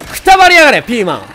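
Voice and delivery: very high, loud combat shout; furious war cry; battle yell. Real, not acted. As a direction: scream it while attacking. Attacking an enemy.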